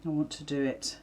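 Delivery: ordinary talk, nothing marked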